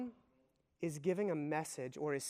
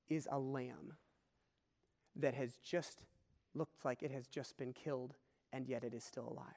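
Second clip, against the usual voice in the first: much softer and lower, hushed with wonder; hushed awe; 6 semitones lower, 9 dB quieter than usual. Real, not acted. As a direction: drop to an awed near-hush. Awed reveal of the slain lamb.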